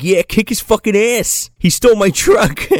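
hammed-up bully yelling encouragement; mock egging on. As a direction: cheer on the fight like a jock. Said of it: "Randy's like".

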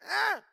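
sharp high imitated baby shriek; baby squeal. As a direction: squeal like a toddler with messy hands. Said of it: "looked at me and went".

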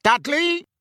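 high, outraged roar of a name. A narrator's reading. Uncle demands answers about his son, roaring. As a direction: burst out loud and high, furious uncle. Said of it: "roared Uncle Vernon".

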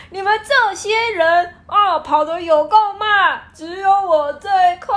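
loud, high cackling brag; gloating winner. Retold or imitated. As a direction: crow about winning, laughing. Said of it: "他会站在终点说". (得意大笑炫耀)

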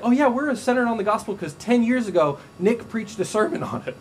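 mock the complacent churchgoer; smug complacency. Preacher mocking a complacent congregation, smug and high. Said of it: "Be like".

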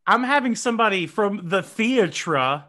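loud, high, hammed-up theatrical delivery; mock-grand. Host joking about hiring a pronunciation coach, mock-grand. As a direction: announce it like a stagey pronunciation pupil, half laughing.

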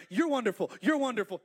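loud, high mock gushing; loudest praise. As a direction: gush the compliment at full volume. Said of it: "The Holy Spirit say".

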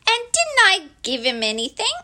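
put-on high, plaintive child's question; sad. A narrator's reading. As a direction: ask it with a touch of sadness. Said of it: "asked Christopher Robin sadly".

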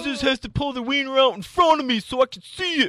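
high, loud, laughing shout; excited shout. Real, not acted. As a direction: yell the dare out loud. Shouting the silly bet.

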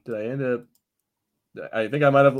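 normal talking voice

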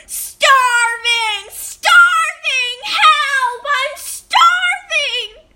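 high, drawn-out wailing yell. A narrator's reading. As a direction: cry out for help, hammed-up starving.

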